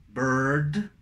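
"bird" rising to a final d; contrast drill. Shown as a demonstration. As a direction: lift "bird", central er and d. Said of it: "es muy útil el contraste". ("bird" ascendente, con D final)

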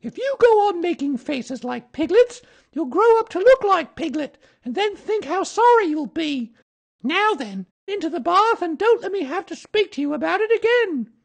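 very high, loud motherly scolding; high-pitched telling-off from mother kangaroo; rather severe. A narrator's reading. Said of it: "said Kanga rather severely".

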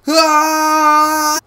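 high shout mid-jump; scream. Real, not acted. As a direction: yell while falling. Jump off bridge.

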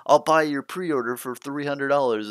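hammed-up scalper making an offer; eager reseller voice. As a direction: play a pushy pre-order flipper.

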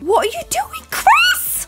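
very high, squeaky exasperation; squeaky disbelief. Real, not acted. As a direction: shoot way up in disbelief. Chris ruins seated kids.

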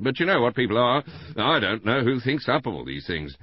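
high, loud, rambling householder; Mister Bland, chattering. A narrator's reading. Husband gossiping to the inspector about the murder, chattering loudly.

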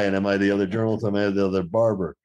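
ordinary delivery

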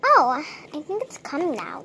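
usual speaking voice

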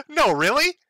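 sarcastic high-pitched no really; mock surprise. Real, not acted. Reacting to the post with mock surprise. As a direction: feign shock at the obvious.

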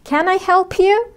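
"Can I help you?" slow and high, voice rising at the end on you; rising question tune. Shown as a demonstration. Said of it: "الصوت في الآخر مرتفع". (نغمة صاعدة في آخر السؤال)

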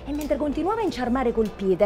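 normal talking voice

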